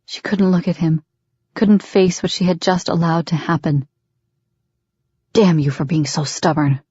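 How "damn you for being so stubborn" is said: clipped angry curse; curt curse. A narrator's reading. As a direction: spit it out shortly. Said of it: "he finally cursed, shortly".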